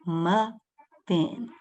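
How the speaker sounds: "Muffin" broken into muh and fin with a gap; two slow, separate beats on Muffin; split into syllables. Shown as a demonstration. Teacher lets children try counting, splitting "Muffin" into two slow syllables. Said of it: "Try again".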